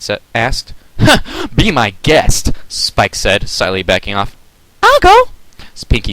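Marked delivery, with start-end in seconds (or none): 1.0-2.5 put-on very slow, higher wary baby dragon; reluctant Spike. Spike declines nervously. 4.8-5.3 very high, louder eager squeak; volunteer with bouncy glee; bubbly Pinkie Pie. Acted.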